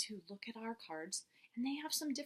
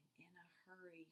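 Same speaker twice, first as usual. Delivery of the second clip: whisper; 5 semitones lower, 20 dB quieter than usual. Real, not acted. Class reads along quietly.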